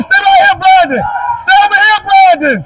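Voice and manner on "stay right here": calling from far away. Real, not acted. Coach calling players on court.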